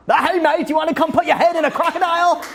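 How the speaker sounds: high, lively mock Aussie; hammed-up cheery Australian pitch; fun Australian accent. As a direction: invite a mate along in cartoon Australian. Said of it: "But Australian, they sound fun".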